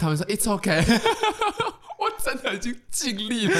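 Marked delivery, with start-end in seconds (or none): 1.0-3.6 high squealing laugh running into the words. Real, not acted. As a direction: crack up and keep talking through it. Laughs at own seatbelt story. (大笑着说)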